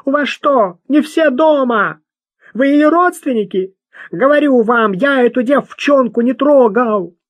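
высокий, громкий гнусавый бродяга; возмущённо отпираться наглым бродягой; возмущённый бродяга. Acted. (гнусавый бродяга отпирается перед Луи)

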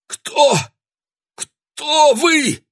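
высокий сдавленный голос; выдавливает слова в ужасе; squeezed out in terror. A narrator's reading. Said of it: "выдавил из себя Себастьян Шанданьяк". (сдавленный испуганный голос)

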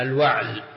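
الوعل said al-wa'l, no vowel after the ayn; one-syllable wa'l in الوعل, ayn left vowelless; sukun on ayn. Shown as a demonstration. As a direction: say الوعل with the ع closed straight onto the lam. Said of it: "وسكونها".

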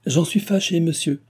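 lightly voiced cold young lady; cold reply. A narrator's reading. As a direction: keep her at arm's length, coolly. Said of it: "répondit Cécile froidement".

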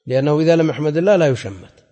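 ordinary delivery

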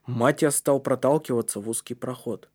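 ordinary delivery, nothing marked

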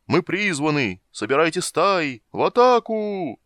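high, drawn-out rallying call; slow, loud battle cry rising to the last word; trumpeting. A narrator's reading. Dragon summoning the flocks to attack, trumpeting. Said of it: "затрубил он".

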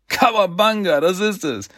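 high, jubilant exclamation; excited cheer. Acted. Turtle hits on flying off the island, high and excited.

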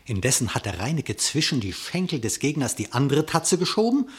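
usual speaking voice

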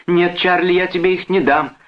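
very high, insistent woman's voice; agitated refusal. A narrator's reading. Sister withholds documents from her brother, agitated. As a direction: refuse in alarm.

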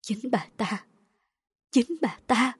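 hushed gasp of recognition, then stronger; shocked realization. A narrator's reading. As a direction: utter it in stunned horror. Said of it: "tá hỏa mà thốt lên". (sững sờ thốt lên)

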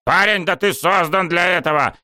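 high-pitched, insistent outburst; indignant cry. A narrator's reading. Coach grabbing student's shoulder, indignant cry. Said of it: "возмущённо завопил он".

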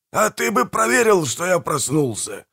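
high-pitched grumbling complaint. A narrator's reading. Manfred blames his brother for not checking, grumbling. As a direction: grumble back, starting high and dropping. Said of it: "проворчал Манфред".

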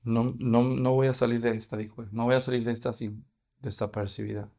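whisper-close dread of being found out; fearful. Acted. Trembling woman realizing she will be exposed, mildly afraid and soft. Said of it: "[name] dice temblando y temerosa".

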